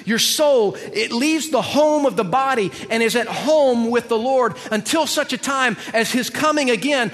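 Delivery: loud, high, ringing sermon delivery; forceful preaching. Real, not acted. Preacher declaring resurrection and judgment to church, forceful preaching. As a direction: drive it home, building to the final word.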